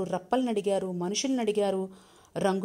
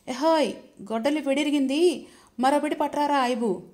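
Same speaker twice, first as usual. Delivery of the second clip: loud call; 6 semitones higher, 6 dB louder than usual. A narrator's reading. Tall man calls his helper, loud call. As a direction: shout higher and louder across the clearing.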